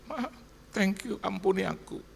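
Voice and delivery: high, pleading imitation; retold emotional apology in a high voice; acted tearful plea. Retold kneeling plea to grieving mother.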